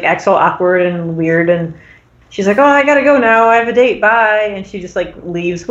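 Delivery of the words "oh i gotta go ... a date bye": higher, louder imitation of a hasty exit; flustered excuse. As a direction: blurt out a mock-awkward goodbye. Rachel flees awkwardly in retold scene.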